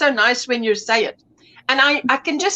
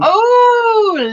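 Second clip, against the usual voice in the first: delighted gasp; 8 semitones higher, 6 dB louder than usual. Real, not acted. Delighted reaction to peppermint blanket.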